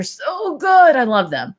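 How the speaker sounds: high, slow, delighted gush. Real, not acted. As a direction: savor it with delight. Gushing over favorite snack.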